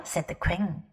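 ordinary delivery, nothing marked

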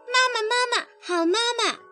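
put-on high, childlike calls to mama; affectionate calling. A narrator's reading. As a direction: call out sweetly in a small child's high voice. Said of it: "亲热地叫着".

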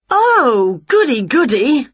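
high, loud glee; delighted witch. A narrator's reading. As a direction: cheer as a witch eager to join in. Winnie delighted to join choir.

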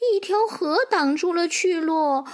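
high, sheepish foal voice; embarrassed little horse. Acted. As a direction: admit it sheepishly in a young high voice. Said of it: "小马难为情地说". (小马难为情地用童声说)

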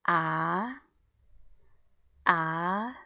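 "ah" rising smoothly like a question; second tone. Shown as a demonstration. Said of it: "read all together again from the beginning".

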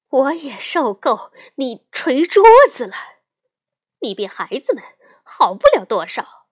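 very loud, exasperated mother; loud retort. A narrator's reading. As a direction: hit back forcefully as a fed-up mama bear. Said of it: "熊妈妈大声说". (熊妈妈恼火地大声回击)

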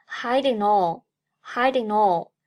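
"hiding all" run together as one word; linked pronunciation. Shown as a demonstration. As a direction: link "hiding all", ng flowing into "all". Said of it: "Hiding和all连读".

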